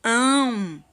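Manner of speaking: sounding out Ão; syllable by syllable. Shown as a demonstration. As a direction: spell out the syllable Ão. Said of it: "E a segunda sílaba?".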